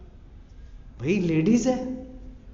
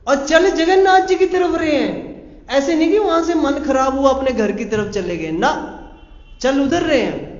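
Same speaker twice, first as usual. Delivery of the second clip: high, loud, fervent emphasis; fervent preaching; 6 semitones higher, 5 dB louder than usual. Real, not acted. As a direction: preach it out with fire, voice raised high. Preacher stresses devotion fervently.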